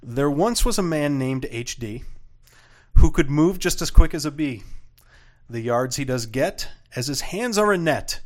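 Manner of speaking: sing-song limerick rhythm; bouncy limerick lilt. Acted. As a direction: recite it with a bouncy meter. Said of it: "here is my limerick".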